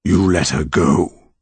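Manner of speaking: slow, measured accusation; solemn monster. A narrator's reading. Original monster to Connor, slow grave verdict. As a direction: lay each word down gravely.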